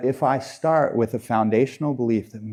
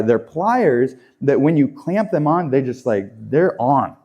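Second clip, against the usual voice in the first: ordinary talk, nothing marked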